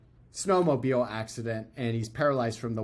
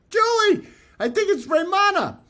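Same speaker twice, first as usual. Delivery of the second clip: much higher, louder call across the house; yelling to wife; 7 dB louder than usual. Retold or imitated. Calling to his wife Julie about the FaceTime, a higher, louder yell. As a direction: shout the news to the next room. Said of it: "I yell over".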